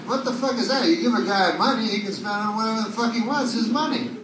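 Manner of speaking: ordinary delivery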